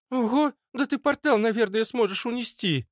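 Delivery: put-on very high, childish wonder; amazed girl. Tiny girl marvels at huge Arnold, high-pitched.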